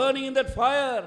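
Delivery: loud, high preaching cry; fiery preaching. Acted. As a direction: thunder it at the congregation. Preaching at full force.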